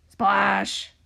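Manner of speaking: punchy, loud sound-effect word; loud splash. A narrator's reading. Reader voicing the waves at sea, loud splash. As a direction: burst it out like hitting water.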